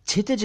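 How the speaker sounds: क्षितिज begun with chh, "chhitij"; wrong chh onset. Shown as a demonstration. Teacher quoting some people: क्षितिज as "chhitij". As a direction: say क्षितिज with chh replacing ksh. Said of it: "कह देते हैं कुछ लोग".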